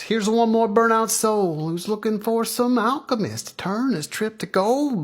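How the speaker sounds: high, sing-out guru voice; guru Baba Fats. Acted. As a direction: pronounce on the seeker from on high.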